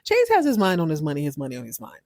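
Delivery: ordinary delivery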